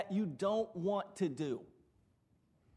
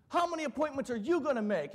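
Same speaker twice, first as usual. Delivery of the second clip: hyped manager; 7 semitones higher than usual. Retold or imitated. A hint of a bank manager psyching up staff. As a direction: pump the team up. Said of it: "try to psych us up".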